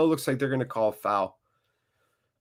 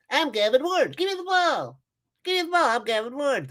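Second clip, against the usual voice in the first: Gavin Ward; 13 semitones higher than usual. Pretend. Fan mocking hated referee for the chat, squeaky-high silly voice. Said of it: "that's the voice I hear when I look at his face".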